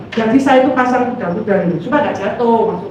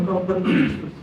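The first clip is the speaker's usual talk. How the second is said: softer, subdued repeat of a preacher's words; let down; 3 semitones lower, 6 dB quieter than usual. Retold or imitated. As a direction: say it with mild disappointment, voice dropped. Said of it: "saya kecewa waktu dia ngomong". (mengulang pelan dengan nada kecewa)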